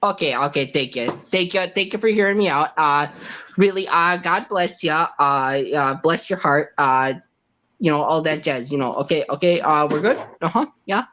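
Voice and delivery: lightly voiced Wisconsin accent. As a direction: play Robbie from Wisconsin, chatty. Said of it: "That was a Wisconsin goodbye".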